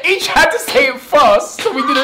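loud, laughing, barely holding it together; cracking up while telling it. Real, not acted. Manager laughing to interviewer about Steve's Chelyabinsk.

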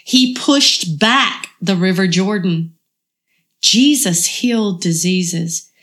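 usual speaking voice

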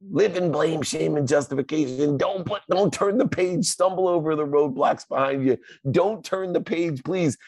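high Muppet pleading; high mock Grover begging you to stop. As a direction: keep the squeaky Grover begging. Grover voice kept for ego.

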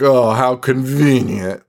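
slow, drawn-out sarcastic jab; mock sarcasm. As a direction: stretch it out with exaggerated suspicion. Acted sarcastic family quarrel.

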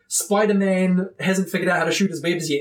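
ordinary talk

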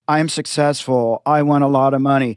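low, listless recital; low-energy affirmation. Shown as a demonstration. Co-host showing how not to say affirmations, low-energy. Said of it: "it's gotta be high energy".

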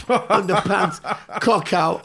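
laughing through the words, slow; cracking up while telling it; giggling at the memory. Real, not acted. Laughing at own story.

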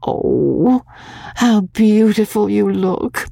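put-on high, slow girlish wonder; awed daughter, voice lifted; admiring exclamation. A narrator's reading. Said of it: "exclaimed Magnolia now".

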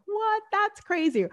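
high, incredulous gasp of disbelief; a lightly voiced friend, shocked; surprised friend. Friend shocked by the fortune teller, mock disbelief.

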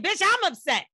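loud, high-pitched retold outburst; indignant comeback. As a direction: throw it back at her, upset and loud. Acting out her indignant comeback.

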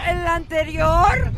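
ordinary talk

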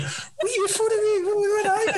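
high, squeaky mock disbelief; shocked disbelief. Telling a friend how he reacted to Michael's call, shocked squeal. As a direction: imitate your own stunned squeal at the phone.

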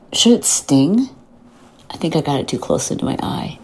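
hushed whisper while dabbing serum near the eye; real whisper. Real, not acted. Whispering to listeners while getting ready with serum. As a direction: breathe the words out with no voice.

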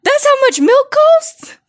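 high, slow and disbelieving; shocked. Acted. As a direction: act out outraged price shock. Mock shock at prices.

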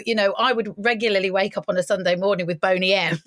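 ordinary delivery

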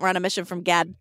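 put-on [name] Chicago accent. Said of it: "in their Chicago accent".

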